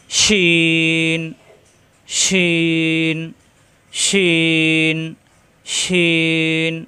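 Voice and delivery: শিন stretched long with a broad sh sound; slow, drawn-out শিন, repeated as a model; Arabic letter shin. Shown as a demonstration. Teacher models শিন for students to copy, slow. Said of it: "তখন হয়ে গেছে শিন".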